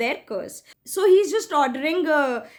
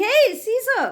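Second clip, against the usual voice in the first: shouting; 7 semitones higher than usual. Acted. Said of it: "he's shouting".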